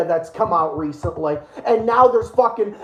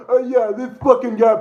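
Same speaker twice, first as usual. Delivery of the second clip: ordinary talk, nothing marked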